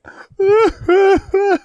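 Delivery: high, loud exaggerated boo-hoo sobs; hammed-up bawling in bursts; mock sobbing. Host showing how he wasn't crying, mock sobbing.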